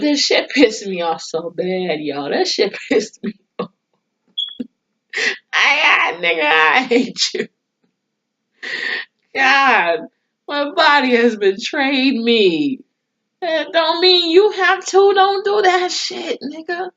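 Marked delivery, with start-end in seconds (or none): 5.5-16.9 exasperated rant. Retold or imitated. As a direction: tell him off like the wronged wife. Ranting at husband as wife.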